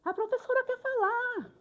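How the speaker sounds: high-pitched imitated yell; high mock shout to hush a classroom; retold shout. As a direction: call out over a noisy class, imitating a pupil. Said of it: "alguém gritou".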